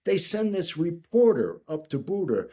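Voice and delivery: usual speaking voice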